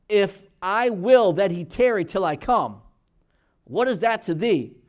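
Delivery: solemn scripture reading. Acted. Jesus' words read from scripture.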